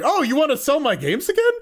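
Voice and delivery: high-pitched imitation of an excited dude; really excited. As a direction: act out someone thrilled, pitch way up. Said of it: "actually really excited".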